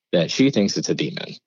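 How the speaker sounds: ordinary delivery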